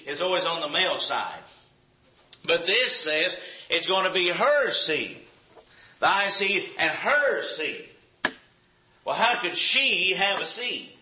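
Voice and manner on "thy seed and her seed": "her" raised and stretched in a slow phrase; stress on HER. Real, not acted. Preacher points out the unusual seed, stress on HER. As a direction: slowly set "her" against "Thy", leaning on "her".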